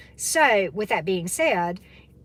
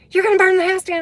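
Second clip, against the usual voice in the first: alarmed warning; 11 semitones higher, 10 dB louder, much faster than usual. Retold or imitated. Friend warns over phone.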